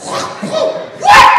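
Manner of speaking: very high, very loud cry of a man slipping and crashing; pained yell after a fall. Retold or imitated. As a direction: howl it as you hit the floor. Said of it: "all you heard was".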